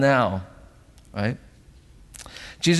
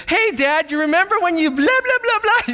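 put-on much higher, louder chirpy kid voice; mock child voice; 4 dB louder than usual. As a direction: play the oblivious little kid. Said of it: "one of your kids goes".

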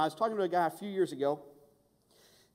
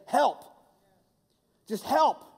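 higher, louder urgent plea; demonstrated desperate plea, much higher and louder; pleading cry for help; 8 semitones higher, 7 dB louder than usual. Shown as a demonstration. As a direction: cry out help like you mean it. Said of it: "which is m-, to say".

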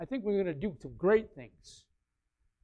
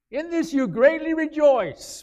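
loud, slow, raised reading; scripture read; 8 semitones higher, 8 dB louder than usual. Real, not acted. Pastor reads scripture aloud to the church, loud and slow. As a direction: declaim the verse.

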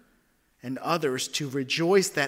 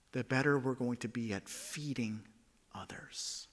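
soft whispered ending; 4 semitones lower, 10 dB quieter than usual. Real, not acted. Pastor ending thought, whispered. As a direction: let it fall to a whisper. Closing point drops away.

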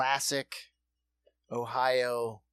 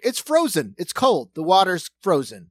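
louder, dopey know-it-all voice; mock clueless city dweller; 4 semitones higher, 7 dB louder than usual. Mocking clueless cityots about frozen water, dopey voice. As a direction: state the obvious like a fool. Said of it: "cityots are like".

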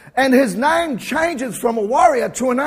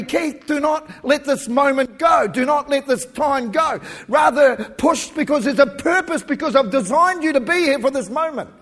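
urgent divine command; 5 semitones higher, 5 dB louder than usual. Retold or imitated. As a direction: voice the Spirit pressing him not to let go. Holy Spirit urging him, retold.

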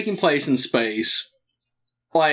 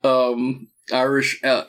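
ordinary talk, nothing marked

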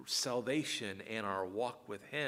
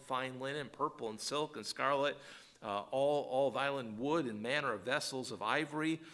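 raised, steady reading of a long list; scripture reading; 3 semitones higher, 3 dB louder than usual. Acted. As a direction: read each item with weight.

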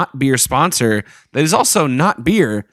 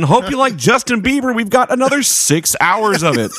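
higher cheesy DJ patter; radio DJ voice; 7 semitones higher than usual. Acted. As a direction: announce it like a pop station host. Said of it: "Checking out a new radio voice".

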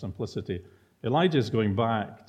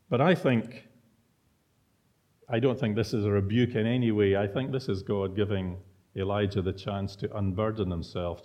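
ordinary talk, nothing marked

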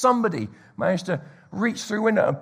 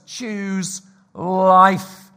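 emphatic command; 2 semitones higher, 8 dB louder than usual. Real, not acted. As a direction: Choose life called out loud and firm. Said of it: "punchy sermon exhortation".

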